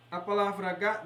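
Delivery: normal talking voice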